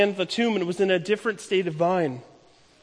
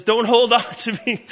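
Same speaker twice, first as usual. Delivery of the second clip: said through a laugh, a bit higher and louder; laughing Jesus quote; 4 semitones higher, 6 dB louder than usual. Acted. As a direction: laugh as you tell her to let go. Jesus quoted, amused at long hug.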